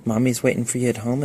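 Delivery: normal talking voice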